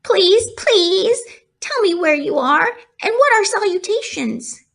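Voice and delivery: high, pleading piglet; eager pleading. Acted. Wilbur begging the hidden voice, eager pleading. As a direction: beg to know.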